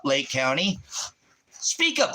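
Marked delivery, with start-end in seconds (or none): 1.5-2.1 high, sharp demand to speak up; raised voice. Real, not acted. Streamer challenging silent watchers, raised voice. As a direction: bark at the watchers to answer. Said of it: "Oh, so I can raise my voice".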